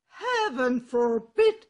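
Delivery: loud, bold donkey voice; a confident ass calling out to a friend; hearty donkey. Acted. Ass echoes the cock.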